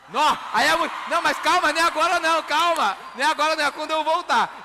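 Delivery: high, loud calling over noise; calm the cheering crowd loudly. Real, not acted. Crowd cheers, he calls them down.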